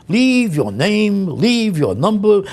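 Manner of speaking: condescending instruction. Retold or imitated. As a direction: talk down like to a moron. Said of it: "A plate of soup?".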